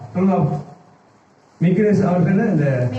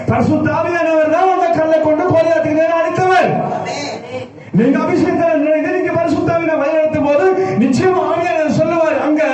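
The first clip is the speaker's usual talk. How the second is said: high, unrelenting preacher's chant-like drive; full-force preaching; 9 semitones higher than usual. Acted. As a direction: pile up the phrases with fervour, pitched high. (உச்சக் குரலில் ஆவேசமான பிரசங்கம்)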